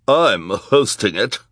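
slow, loud character snarl. A narrator's reading. Socialite correcting the villain about hosting, snarling. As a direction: snarl it, drawing out each word. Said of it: "snarled the voice of Esme Squalor".

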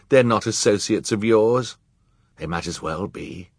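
usual speaking voice

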